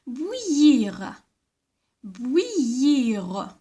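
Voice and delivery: "bouillir" stretched slowly, each part distinct; careful pronunciation. Shown as a demonstration. Teacher repeating the word to children, careful pronunciation. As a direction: draw out "bouillir" for a class to hear. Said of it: "Il faut les bien prononcer".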